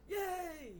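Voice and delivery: high, drawn-out mock cheer; gleeful cheer. Frog cheers at being turned green, gleeful. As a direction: cheer it out like a delighted little frog.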